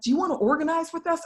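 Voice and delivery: a hint of a chirpy recruiter; imitated group organizer. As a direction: lightly play the keen organizer asking. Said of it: "was like".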